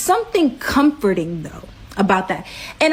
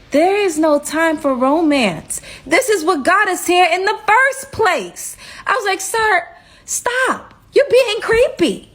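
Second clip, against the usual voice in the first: much higher, slow, scolding shutdown; indignant brush-off; 9 semitones higher than usual. Retold or imitated. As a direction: scold him to stop being creepy. Rebuffing his romantic staring.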